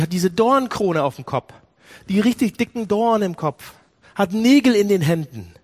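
ordinary talk

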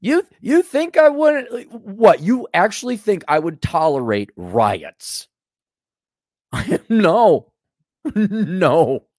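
high, drawn-out incredulous opening; mock disbelief. President answering rioters, incredulous. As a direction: act astonished, then refuse emphatically.

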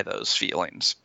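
drops to a whisper on those feelings. Real, not acted. Therapist whispering the point to the listener. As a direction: breathe the words out, no voice.

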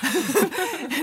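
a short high burst of laughter. Real, not acted. Laughs at the joke.

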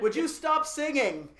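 high, loud exasperated snap; fed up, telling them off; annoyed. Retold or imitated. Annoyed aside to organist.

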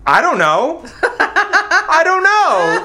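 laughing helplessly, high; laughing admission. Real, not acted. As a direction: giggle it out. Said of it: "this is my answer".

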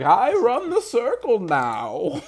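put-on old Emma Watson. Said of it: "They'll have old Emma Watson on screen".

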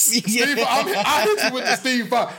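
amused, laughing repeat. Real, not acted.

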